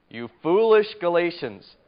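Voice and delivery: loud, stern cry of rebuke; exclaimed rebuke. Shown as a demonstration. Teacher reading Paul's rebuke to the Galatians, loud and stern. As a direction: say it like Paul scolding them. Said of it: "you have to read that in your mind".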